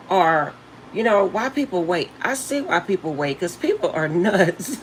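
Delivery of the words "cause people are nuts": breaks into a high wheezing laugh. Real, not acted. Telling viewers people are nuts, laughing hard. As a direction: laugh it out, can't hold it in. Laughing at her own joke.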